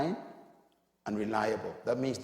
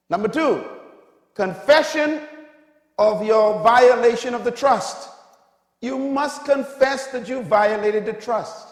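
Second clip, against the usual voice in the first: loud, raised sermon point; full-force preaching; 8 semitones higher, 9 dB louder than usual. Real, not acted. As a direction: proclaim the rule to the congregation, high and loud.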